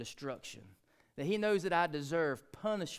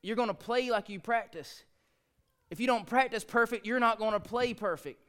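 lecturing dad; 7 semitones higher, 4 dB louder than usual. Retold or imitated. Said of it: "I went to her and I said".

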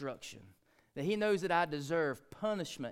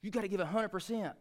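lecturing dad; 7 semitones higher, 4 dB louder than usual. Retold or imitated. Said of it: "I went to her and I said".

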